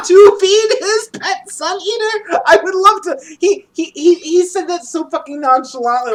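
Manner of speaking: high, loud, cracking up while talking; laughing disbelief. Real, not acted. Telling the cohost about feeding the Sun Eater, laughing in disbelief. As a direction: squeal it through laughter.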